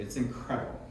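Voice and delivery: ordinary talk, nothing marked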